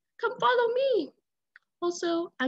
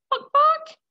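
very high, loud bawk bawk; triumphant little hen; happy clucking; 8 dB louder than usual. Acted. Chicken clucks happily.

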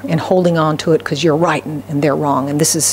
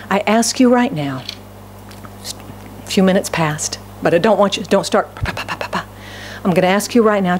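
usual speaking voice

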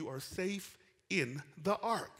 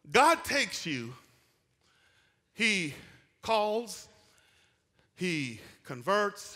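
slow emphatic listing; 4 semitones higher, 6 dB louder, much slower than usual. Real, not acted.

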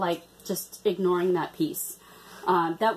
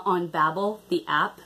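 ordinary talk

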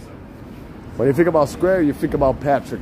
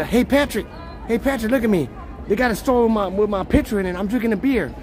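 higher put-on voice for a quote; voiced character; 6 semitones higher than usual. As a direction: speak for him, pitched up.

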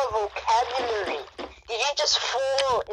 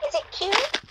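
put-on cutesy high baby voice, climbing higher; 14 semitones higher than usual. Shown as a demonstration. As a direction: show off a baby voice. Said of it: "wanna hear my baby voice".

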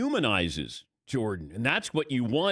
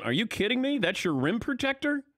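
mock disbelief; 10 semitones higher than usual. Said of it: "They would be going".

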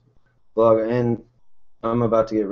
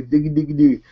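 put-on baby babble; 4 semitones higher, 4 dB louder, faster than usual. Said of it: "she's got me saying".

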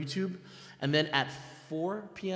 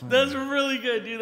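high, loud, drawn-out delighted praise; excited praise; 12 semitones higher, 4 dB louder, slower than usual. Real, not acted. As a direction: gush over a friend's impression. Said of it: "delighted reaction to impression".

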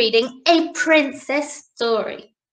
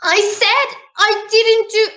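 put-on mild high-pitched child's wail; protest, starting high and easing down; storybook wail of innocence; wailing princess; 6 semitones higher, 4 dB louder than usual. A narrator's reading. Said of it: "she wailed".

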